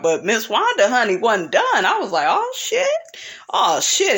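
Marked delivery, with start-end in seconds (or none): 2.3-2.9 much higher, sudden gasp of surprise; surprised. Real, not acted. Recapper seeing round two begin, surprised squeal. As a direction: jump up in pitch as the fight starts.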